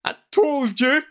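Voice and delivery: high, loud, drawn-out tearful wail; tearful gasp. Retold or imitated. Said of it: "gasps through tears".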